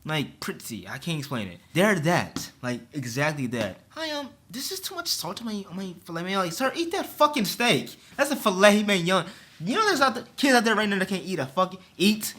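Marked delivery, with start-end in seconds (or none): 3.9-6.4 prissy rich customer, much higher, softer; snooty customer. Retold or imitated. Mocking a rich customer complaining about his steak, high and prissy. 6.5-9.2 mock retort. His own imagined retort.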